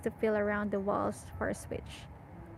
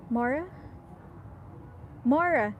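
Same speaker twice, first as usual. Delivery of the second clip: shouting a name; 3 semitones higher than usual. A narrator's reading. Narrator shouting for Mora through the door. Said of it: "I shout".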